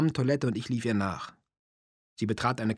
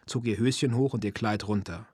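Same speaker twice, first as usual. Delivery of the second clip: usual speaking voice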